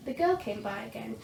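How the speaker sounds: usual speaking voice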